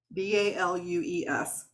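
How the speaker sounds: V A L U E S spelled letter by letter. Shown as a demonstration. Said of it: "in case you can't understand my Southern accent".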